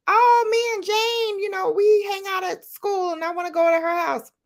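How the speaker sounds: put-on high, pleading kid voice; whiny child plea. Retelling asking to visit a friend's house, whiny kid voice. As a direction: beg like a little girl.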